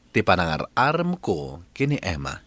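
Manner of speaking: usual speaking voice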